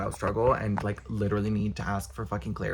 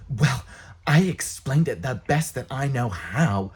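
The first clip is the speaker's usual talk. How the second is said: mock defensive; 3 semitones higher, 5 dB louder, slower than usual.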